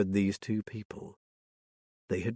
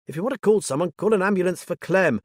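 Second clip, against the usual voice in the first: higher, louder angry command; angry order; 8 semitones higher, 5 dB louder, faster than usual. Acted. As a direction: snap the order at the crowd. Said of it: "Anger flooded through Liz".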